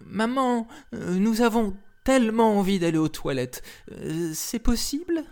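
put-on high, hesitant child's plea; little girls' voice. A narrator's reading. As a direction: ask timidly like little girls. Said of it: "demandèrent-elles".

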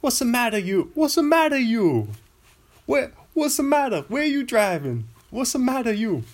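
high, hammed-up New York cabbie; New York accent. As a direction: yell like an old-school New York cabbie. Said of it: "old school New York City".

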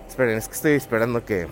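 panting while walking and talking; breathless, words pushed out between breaths. Real, not acted. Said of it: "no puedo respirar ni hablar bien y se me va el aire". (sin aliento, jadeando al hablar)

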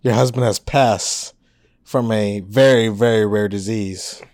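low, slow solemn doctor; grave doctor voice. Acted. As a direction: deliver the news, slow and low. Doctor delivers bad news.

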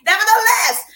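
high, loud nevertheless; loud reset. Real, not acted. Host cutting off her own joke, loud and high. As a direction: snap back on track. Pulls herself back loudly.

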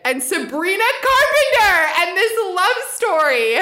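high-pitched, climactic announcement; excited big reveal. Real, not acted. Unveiling a love triangle theory to co-hosts, excited. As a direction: build it up like a grand reveal.

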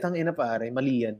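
low, soft grumbling curse; grumpy stoned guy. Retold or imitated. A hint of an annoyed stoner cursing the comic for refusing the joint. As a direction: mutter it like a sulky stoned man. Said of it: "getting so pissed off at me".

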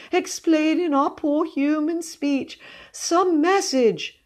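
high, slow, drawn-out entreaty; ardent pleading. A narrator's reading. Cousin begging a ghost for human speech, ardent. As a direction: beseech it fervently, lingering on each word. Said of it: "she pleaded ardently".

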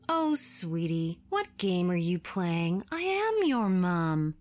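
put-on slow, sickly-sweet stranger coaxing a child; creepy fake mum. Stranger pretending to be the boy's mum, slow false sweetness. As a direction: lull the kid like you're his mother, too slowly.

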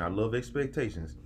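ordinary talk

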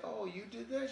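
high, surprised imitation of her; she's amazed he made the hats; impressed girl.

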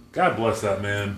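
ordinary delivery

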